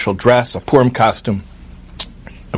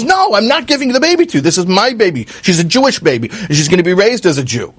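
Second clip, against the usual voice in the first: high, fast, defiant refusal; a young mother clutching her baby, refusing; 9 semitones higher, faster than usual. Retold or imitated. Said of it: "She says".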